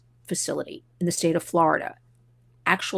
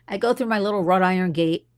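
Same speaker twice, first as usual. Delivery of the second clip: normal talking voice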